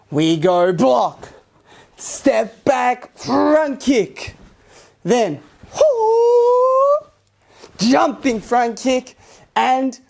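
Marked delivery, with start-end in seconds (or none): 5.0-7.1 high sharp hoo cry; sharp high-pitched hoo; karate cry. Shown as a demonstration. Karate teacher's crane jump cry.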